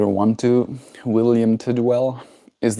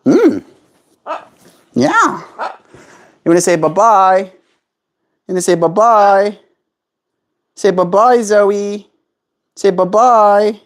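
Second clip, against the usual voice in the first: higher, louder sing-song bye-bye; pet baby talk; 10 semitones higher, 4 dB louder than usual. Acted. Asking pet bird Zoe to say bye-bye, higher sing-song. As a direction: coax the bird to wave goodbye.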